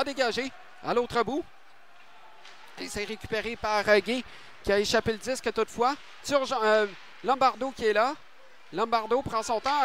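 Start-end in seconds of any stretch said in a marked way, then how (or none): none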